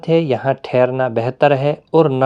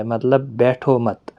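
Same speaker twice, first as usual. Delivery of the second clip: normal talking voice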